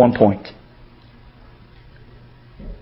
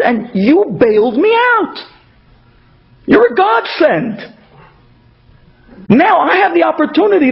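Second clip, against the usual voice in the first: grateful joy; 8 semitones higher than usual. Retold or imitated. Host thanking the poor guest for coming, high and overjoyed. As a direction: gush with gratitude at a godsend.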